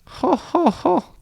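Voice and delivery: falsetto mock laugh up high; forced high laugh; high fake "ho ho ho". A narrator's reading. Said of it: "Count Olaf said".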